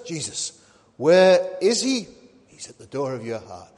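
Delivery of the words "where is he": loud, slowed-down rhetorical question; preacher calling out a question. Acted. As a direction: boom it out slowly to the congregation. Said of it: "Who speaks in this verse?".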